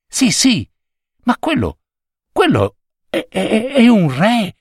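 put-on high, halting child's astonishment; amazed discovery. A narrator's reading. Children spot a king in the garden, amazed and halting. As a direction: stammer it out in high-pitched wonder.

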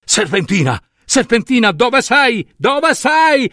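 high-pitched searching call, climbing; anxious storybook cry into the distance. Acted. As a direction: call urgently for someone out of sight. King calls out to distant voice.

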